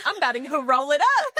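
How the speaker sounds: mock-eager announcement, voice jumping up; retold excited declaration. Retelling a friend's toilet-paper find to cohosts, excited.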